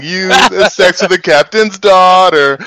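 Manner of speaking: slow, high playground singsong; childish taunting. Retold or imitated. Co-host imitating partner skipping around, childish slow taunt. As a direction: tease slowly like a skipping child. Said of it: "skipping around saying".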